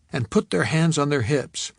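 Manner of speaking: ordinary delivery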